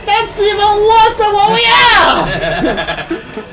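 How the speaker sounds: high, loud, slow yell through laughter; laughing shout. Real, not acted. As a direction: shriek it while laughing. Reacting to video, laughing shout.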